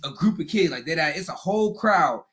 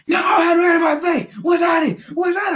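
angry yelling; 10 semitones higher than usual. Retold or imitated. Kevin to the guard, still shouting mad. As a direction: bellow it, still fired up.

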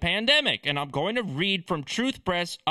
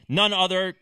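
ordinary talk, nothing marked

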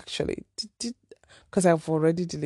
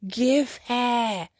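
playful higher-pitched God, savoring each gift with mms; lightly put-on God voice, relishing it; God bestowing generously; 6 semitones higher, much slower than usual. God handing out blessings to her, playfully savoring. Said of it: "God was just like".

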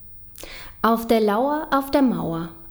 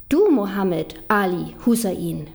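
normal talking voice

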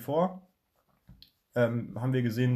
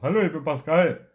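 a light mock of his friend's voice, a bit louder; imitating his voice; 4 semitones higher, 6 dB louder than usual. Teasing his friend by copying his voice. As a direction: lightly put on his buddy's voice. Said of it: "Du hast deine Stimme dort".

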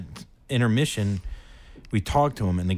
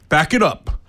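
loud, raised, punchy order; bossy usher; 4 semitones higher, 6 dB louder than usual. Retold or imitated. Usher ordering fans out of the aisle, bossy bark. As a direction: bark it like a power-tripping usher. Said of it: "when ushers, like, try to tell everyone".

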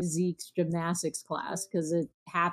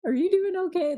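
caring son; 10 semitones higher, faster than usual. Retold or imitated. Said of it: "the one question that he asks".

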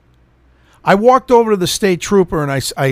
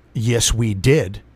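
slow, low imitation of the clerk; creepy clerk; 7 semitones lower, 4 dB quieter, much slower than usual. Clerk insisting to a waiting customer, slowly mimicked. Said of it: "This is how she talked to me".

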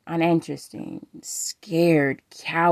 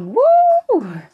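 louder and higher, real thrill; excited whoop; 11 dB louder than usual. Real, not acted. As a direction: let it burst out of you. Hyped about the sweet words.

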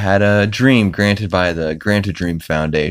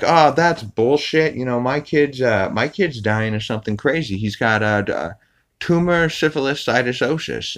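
Peter voice; 6 semitones higher than usual. Retold or imitated. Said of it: "Peter's like".

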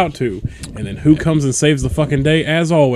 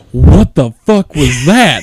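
loud, slow, stunned demand; startled outburst; 6 dB louder, slower than usual. Retold or imitated. Sentient belt after a lightning dash, loud mock shock. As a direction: blurt it out loudly, stretching each word.

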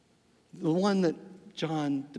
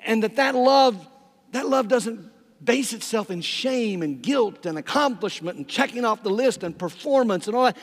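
loud, raised-pitch preacher building momentum; impassioned preaching; 4 semitones higher, 8 dB louder than usual. Acted. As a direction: preach it forcefully, phrase piled on phrase.

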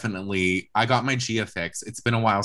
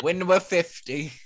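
lightly voiced old person, slow; a hint of an old lady, drawn out; elderly voice; 8 semitones higher, slower than usual. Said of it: "I'm giving, like, an elderly voice".

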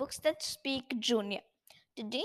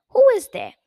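high, loud frightened cry; scared squeak; 10 semitones higher, 13 dB louder, faster than usual. Acted. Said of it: "He shivered in fear and stammered".

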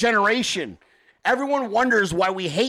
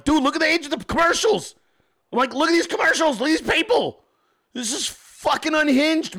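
higher, exasperated mock rant; 7 semitones higher than usual. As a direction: push it up like you can't believe it. Retold self ranting at [name].

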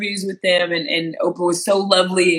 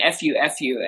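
usual speaking voice

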